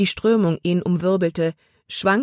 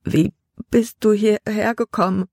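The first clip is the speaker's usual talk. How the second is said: chattering teeth; 5 semitones higher than usual. A narrator's reading. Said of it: "mit klappernden Zähnen".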